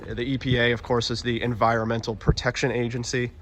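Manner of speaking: ordinary talk, nothing marked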